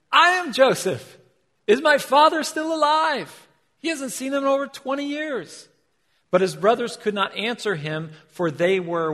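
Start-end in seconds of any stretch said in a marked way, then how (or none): 0.1-5.7 high, loud cry of recognition; Joseph revealing himself. Acted. Joseph reveals himself to his brothers, high loud cry. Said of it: "Joseph said to his brothers".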